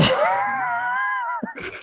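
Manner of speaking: high squealing laugh; burst of laughter. Real, not acted. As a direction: crack up mid-line. Breaks up after bad accent.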